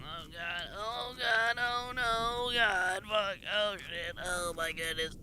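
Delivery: high, strained panicked exclamations; anxious whimpers. Real, not acted. Streamer threading a dangerous gap, panicked fretting. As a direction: fret through each one under your breath.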